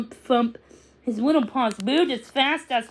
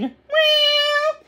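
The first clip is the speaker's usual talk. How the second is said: high, loud drawn-out meow; kitten meow; 14 semitones higher, 4 dB louder than usual. Acted. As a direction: meow loudly like a kitten calling. Kitten meows from landing.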